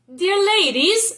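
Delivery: high, loud courtly greeting; a gallant young lord hailing the ladies; lightly played suitor calling out; charming nobleman.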